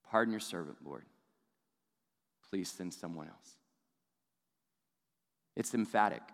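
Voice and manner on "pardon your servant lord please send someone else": soft, low pleading; begging. Retold or imitated. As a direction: Moses begs quietly to be spared. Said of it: "And so he just begs".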